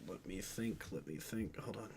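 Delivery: soft muttering to self. Real, not acted. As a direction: mutter while thinking. Thinking aloud, muttering.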